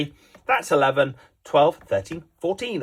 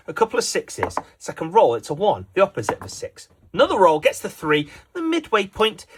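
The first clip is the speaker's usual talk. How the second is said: ordinary talk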